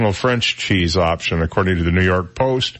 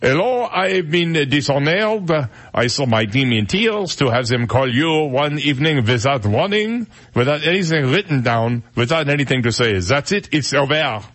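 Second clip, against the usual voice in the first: mock French chef, wounded pride; French accent; 4 semitones higher than usual. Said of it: "I could do the French accent".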